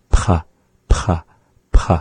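P, P, P with a sharp puff of breath; breathy isolated p; aspirated p. Shown as a demonstration. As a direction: pop each P with air from the back. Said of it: "you have that at the back there".

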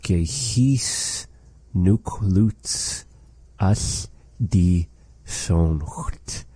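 very slow, careful demo pronunciation; teacher's slow model line; slow model phrase. Shown as a demonstration. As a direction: spell it out slowly for learners. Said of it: "much resembling the first phrase".

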